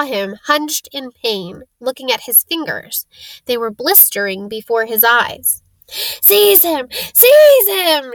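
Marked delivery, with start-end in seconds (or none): 5.9-8.1 high shriek building to full volume; frantic, rising shriek of rage. Acted. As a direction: scream it louder the second time. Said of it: "shrieked Voldemort again".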